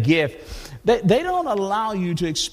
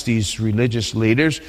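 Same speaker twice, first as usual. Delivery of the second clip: ordinary talk, nothing marked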